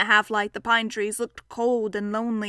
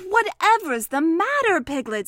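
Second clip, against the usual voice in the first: concerned Christopher Robin; 5 semitones higher, 4 dB louder than usual. Acted. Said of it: "said Christopher Robin".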